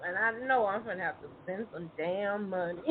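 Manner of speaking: normal talking voice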